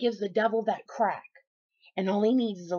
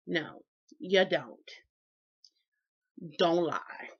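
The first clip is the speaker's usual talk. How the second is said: normal talking voice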